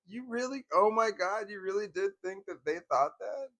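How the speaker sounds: mock-outraged husband asking his wife if she's serious; incredulous disbelief. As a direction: act stunned she really believed it. Retelling argument with his wife.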